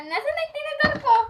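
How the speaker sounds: excited rush. Real, not acted. Hunting for fork mid-race.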